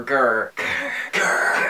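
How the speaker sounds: whispered growl. Pretend. Snowman menacing the dog, whispered growl. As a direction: whisper a growl under your breath. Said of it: "which is just whisper".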